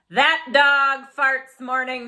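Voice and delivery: loud, slow, exasperated father; grumpy father. Acted. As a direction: complain big and slow like a fed-up dad. Father voiced in story.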